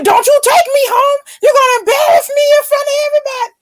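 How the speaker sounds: high, loud retold outburst; outraged protest. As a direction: act out a shrieking teenage protest. Retelling her angry protest to date.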